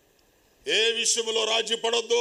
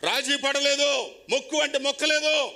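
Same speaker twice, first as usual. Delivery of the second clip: emphatic declaration; 7 semitones higher, 4 dB louder than usual. Real, not acted. Emphatic point about Jesus.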